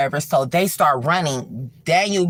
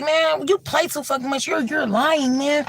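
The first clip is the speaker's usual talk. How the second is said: higher, dismissive "you're lying"; disbelieving friend; 11 semitones higher than usual. Retold or imitated. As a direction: brush him off, not buying it. Daniel disbelieving Scooter.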